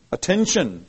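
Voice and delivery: ordinary delivery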